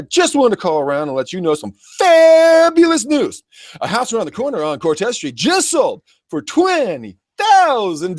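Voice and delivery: high, hammed-up sleazy sales voice; slick salesman pitch. Mocking a slick real estate agent on a cold call, hammed-up sales pitch. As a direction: oversell it like a cheesy cold caller. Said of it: "they weren't like".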